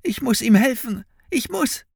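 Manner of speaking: put-on very high, desperate boy's plea; pleading. A narrator's reading. Boy begs captor to let him help, desperate. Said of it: "flehte Finn".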